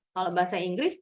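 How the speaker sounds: ordinary talk, nothing marked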